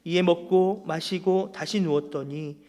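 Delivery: loud, formal Bible reading to the congregation; scripture reading. Acted. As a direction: proclaim the verses firmly, line by line. Reads the scripture passage aloud. (성경 본문을 힘 있게 봉독하듯)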